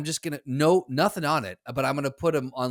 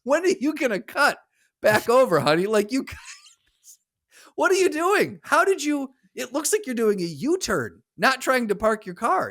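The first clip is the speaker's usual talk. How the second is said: high, louder sarcastic scolding of a bad parker; mock scolding; 8 semitones higher, 4 dB louder than usual. Teasing a driver who parks like a U-turn, mock sweet scolding. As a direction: talk down to her like a lost child, honey and all.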